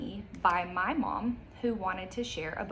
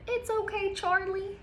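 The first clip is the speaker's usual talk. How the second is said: put-on much higher, gentle child's voice; reassuring child voice; 13 semitones higher than usual. Child comforting dog at the vet, high gentle voice. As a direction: soothe the dog like a little kid. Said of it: "It says".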